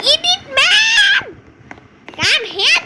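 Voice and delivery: high, slow, drawn-out shout of the name; calling out. Real, not acted. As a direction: yell the name across the map. Calling teammate in game.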